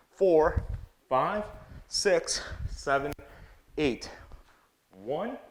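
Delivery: rhythmic punchy combo calls; boxing coach calling combos. Acted. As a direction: bark out each punch in time. Calls eight count combos.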